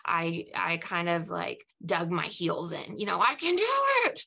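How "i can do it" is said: high, loud burst of resolve; stubborn determination. Retold or imitated. Recalling her stubborn younger self, high and determined. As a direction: chirp it out like a stubborn kid.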